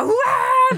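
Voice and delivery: high, loud mock shriek; imitated child's tantrum wail. Mother imitating her son carried downstairs, high tantrum shriek. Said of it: "han bara".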